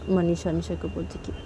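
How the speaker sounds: plain reading voice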